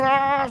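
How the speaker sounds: long, high exasperated "aaah"; exasperated groan. Acted. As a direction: groan like a driver stuck waiting. Conductor desesperado que espera. (quejido agudo de desesperación)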